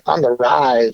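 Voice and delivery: a hint of a low mock host voice; Jason impression. Guest echoing the host's catchphrase back at him, light impression. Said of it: "in my Jason voice".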